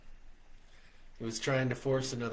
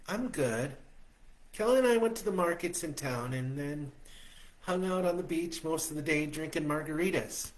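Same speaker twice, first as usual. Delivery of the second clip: higher, brighter woman's voice; Stella's voice; 6 semitones higher, 3 dB louder than usual. A narrator's reading. Stella greeting him on the phone, much higher. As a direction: lift into a happy woman on the phone.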